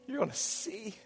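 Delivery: reverent whisper, awed. Acted. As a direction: whisper it like a secret promise. Preacher hushes the promise.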